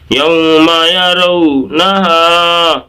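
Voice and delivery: measured, stretched teaching chant of یوم یرونہا; drawn-out recitation. Shown as a demonstration. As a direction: slowly chant یوم یرونہا with sustained vowels. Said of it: "اس طرح سے".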